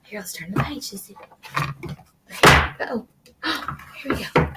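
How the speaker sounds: plain reading voice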